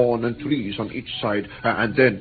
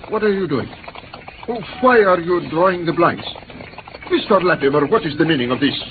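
alarmed indignation; 6 semitones higher, faster than usual. Acted. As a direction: demand an answer in rising panic. Victim sees the blinds drawn.

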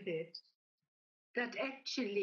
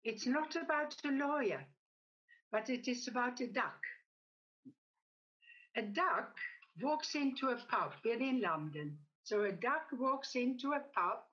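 usual speaking voice

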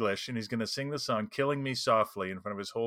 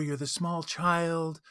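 put-on slow, higher small-child voice; 5 semitones higher, much slower than usual. Shown as a demonstration. Game master showing players a subtle voice change, small child. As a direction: lift your own voice slightly into a child's. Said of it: "subtly change your own voice".

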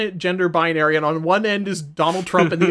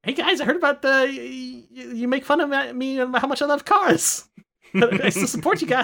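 Jay Leno impression; 6 semitones higher than usual. Doing Jay Leno voice bit.

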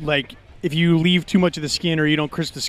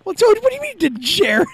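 indignant disbelief; 12 semitones higher than usual. Real, not acted. Indignant question.

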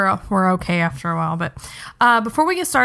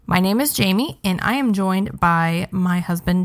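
usual speaking voice

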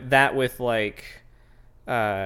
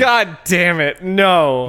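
exasperated outburst; 6 semitones higher, 9 dB louder, much slower than usual. Real, not acted.

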